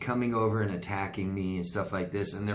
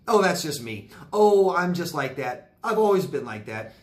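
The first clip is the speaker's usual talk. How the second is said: higher, louder mimicked excuse; mock dismissive excuses; 6 semitones higher, 5 dB louder than usual. Quoting people who make definitive statements, mock dismissive. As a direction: imitate people brushing it off, pitched up.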